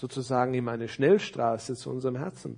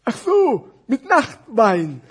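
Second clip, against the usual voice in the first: high, loud, very slow realization; exaggerated puppet voice falling in pitch; dim fat scarf; 8 semitones higher, 12 dB louder, much slower than usual. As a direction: stretch it out, confidently muddled. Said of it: "sagte der Schal".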